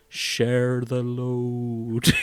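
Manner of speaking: drawn-out, very slow quote; slow-motion Sam. Retold or imitated. Friend quotes Sam in slow motion, very slow. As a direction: stretch every word like a slow-motion film. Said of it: "Sam is just saying in slow motion".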